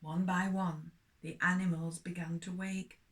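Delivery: lively storybook reading for little ones; story reading for children. Acted. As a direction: tell it like a bedtime story to children.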